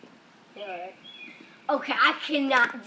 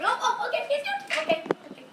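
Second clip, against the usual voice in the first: excited; 14 semitones higher than usual. Real, not acted. Bird nearly escapes.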